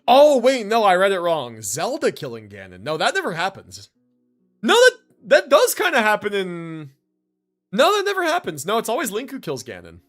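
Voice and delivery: ordinary talk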